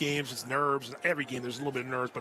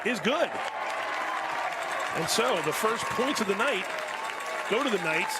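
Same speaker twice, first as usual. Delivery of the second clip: higher, lifted announcer voice; excited score call; 5 semitones higher than usual. Real, not acted. Announcer reports the first points of the night, excited. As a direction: announce the made kick with real excitement. Calling a successful field goal.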